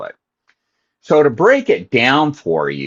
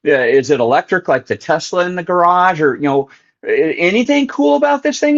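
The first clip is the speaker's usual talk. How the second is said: higher-pitched, mock-indignant questioning; incredulous protest; 8 semitones higher than usual. To his brother about Dad's old boat, incredulous. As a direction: pile up the questions in disbelief.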